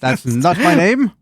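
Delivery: mock-offended creature insisting on its name; indignant creature. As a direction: object loudly, drawing it out. Creature objects to being misnamed.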